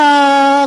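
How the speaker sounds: a short loud giggle. Real, not acted. Wife giggling at her husband, a short giggle. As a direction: burst into a silly giggle. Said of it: "희죽희죽 웃었어요".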